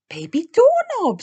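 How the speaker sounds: put-on high, loud Baby Doorknob; proud suggestion. A narrator's reading. Peppa offering a silly name, high and loud. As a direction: announce it proudly.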